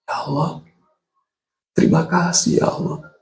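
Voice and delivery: a hushed, moved thank-you to God; grateful weeping prayer. Retold or imitated. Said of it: "Tapi kemudian nangis lagi".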